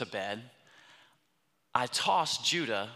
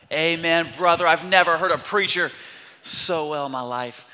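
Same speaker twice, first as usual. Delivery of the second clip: loud, high amen-corner holler; fired-up shouting; 4 semitones higher, 10 dB louder than usual. Retold or imitated. Trent cheers on the preacher, fired up. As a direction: imitate a fired-up congregant, shouting it out.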